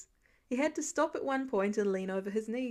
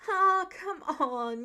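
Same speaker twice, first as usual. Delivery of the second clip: high, slow groaned complaint; groaning protest; 6 semitones higher, 4 dB louder, much slower than usual. A narrator's reading. Peter groans as the kids corner him, drawn-out whine. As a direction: groan it out like a caught teen. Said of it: "Peter groaned".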